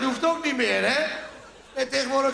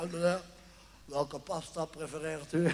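waiter; 5 semitones lower, 10 dB quieter than usual. Retold or imitated. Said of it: "een ober die aan je tafeltje komt".

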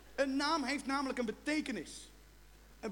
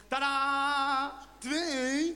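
triumphant tadaa; 4 dB louder, much slower than usual. Retold or imitated. Presenting two cats to girlfriend.

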